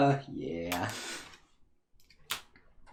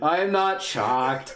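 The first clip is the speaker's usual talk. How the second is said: usual speaking voice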